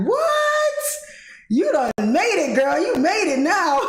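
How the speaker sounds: high squeal into celebration; high, exclaimed congratulations, stretching the ending; amazed, proud dad. Retold or imitated. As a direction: imitate an overjoyed dad cheering you on. Dad retold, thrilled and amazed.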